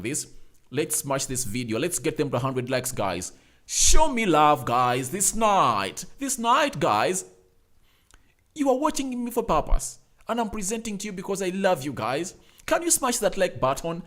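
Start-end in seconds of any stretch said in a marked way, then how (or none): 3.7-10.5 loud, hyped-up plea to the viewers, stretching out "night"; rally the audience like a hype man, big and loud; loud hype call. Real, not acted. Host begging viewers for likes, loud hype shout.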